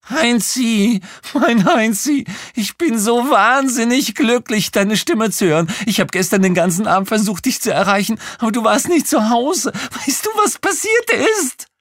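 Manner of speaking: very high, loud shrill female voice; shrieking woman on phone. Acted. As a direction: screech it like an excited woman on the line. Kreischende Anruferin spricht weiter. (kreischende Frauenstimme am Telefon)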